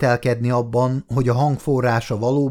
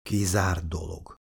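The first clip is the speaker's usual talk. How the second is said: slow, much softer mutter under breath; 5 semitones lower, 8 dB quieter than usual. A narrator's reading. Tommy doubting his brother can climb, muttering softly. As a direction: grumble it to yourself, slowly. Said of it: "motyogta Tommy".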